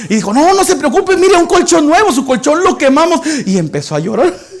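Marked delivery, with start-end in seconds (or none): 0.3-3.2 cheerful youths. Acted. Youths reassure the beggar.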